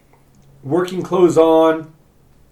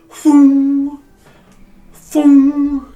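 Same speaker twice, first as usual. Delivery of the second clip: imitated hammer blows; 12 semitones higher, 9 dB louder than usual. Hammering sounds from the smithy.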